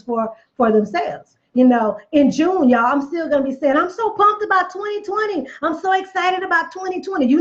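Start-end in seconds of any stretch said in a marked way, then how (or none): 3.8-7.3 high, bubbly excitement about the year; pumped and excited. Pretend. Preacher imagining her thrilled self in June, excited. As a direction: sell how thrilled you are.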